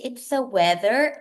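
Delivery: ordinary delivery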